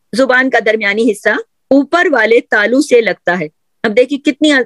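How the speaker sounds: ordinary delivery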